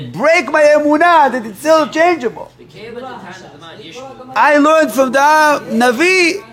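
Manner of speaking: high, loud desperate pleading; pleading mother. Acted. Grieving mother insists she wants the rabbi, high pleading. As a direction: cry it out, refusing to give up.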